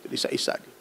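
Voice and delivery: normal talking voice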